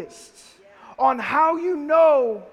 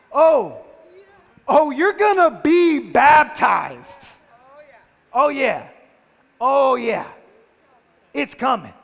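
loud, slow, mocking singsong; sarcastic mom threat; 7 dB louder, slower than usual. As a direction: lay on a mother's sarcastic warning. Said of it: "like only your mother can, turns it into an insult".

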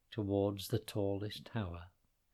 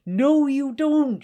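very high, loud, slow shout; 14 semitones higher, 12 dB louder, slower than usual. Acted. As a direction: shout to stop him. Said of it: "shouted Hannah".

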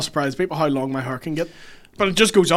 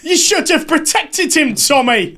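loud, high, drawn-out bad Cockney; hammed-up Green Street British accent; terrible British accent; 12 semitones higher, 7 dB louder, slower than usual. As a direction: wail it in an awful London accent. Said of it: "Worst British accent".

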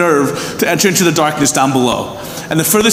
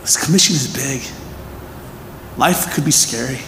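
soft pastoral comfort; 6 semitones lower, 7 dB quieter than usual. Acted. Pastor gently comforting his people, soft and hushed. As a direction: ease them gently, every word an embrace.

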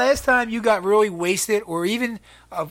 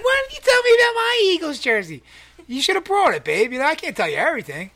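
mock girlfriend; 9 semitones higher than usual. Imitating girlfriend scolding about jersey.

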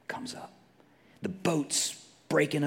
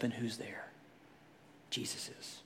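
hushed whisper; 5 semitones lower, 14 dB quieter than usual. Real, not acted. Preacher whispering a teaser to the congregation. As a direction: drop to a breathy whisper.

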